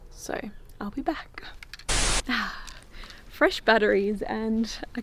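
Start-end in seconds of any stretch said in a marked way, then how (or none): 0.1-3.3 whispering. Real, not acted. Whispered aside, then sigh.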